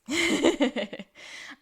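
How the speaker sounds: loud real laugh; laughing at the silly names. Real, not acted.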